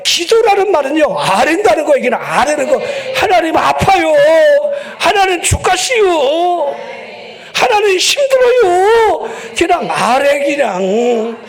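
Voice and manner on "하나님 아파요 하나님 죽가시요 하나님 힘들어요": high, slow, half-crying plea; whiny tearful prayer. Acted. Pastor acts out a complaining prayer.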